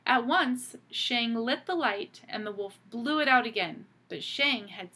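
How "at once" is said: ordinary delivery, nothing marked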